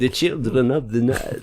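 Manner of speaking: put-on slow, soft vampire accent; Transylvanian accent. Repeating his Dracula line to friends, soft slow accent. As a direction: breathe it slowly like a vampire count. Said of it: "now I sound like a Transylvanian pimp".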